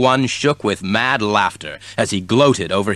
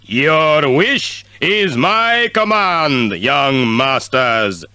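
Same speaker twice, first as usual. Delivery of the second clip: genie voice; 2 semitones higher, much slower than usual. A narrator's reading. Genie voice in story.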